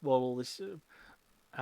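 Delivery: usual speaking voice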